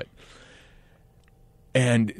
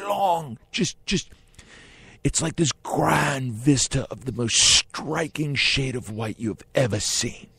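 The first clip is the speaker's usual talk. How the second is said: slow, rising higher on the big words; grandiose director; 5 semitones higher than usual. Retold or imitated. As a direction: sell the vista like a showman. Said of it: "And he goes".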